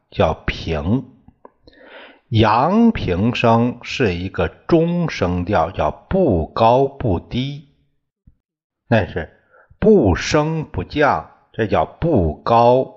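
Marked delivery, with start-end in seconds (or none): none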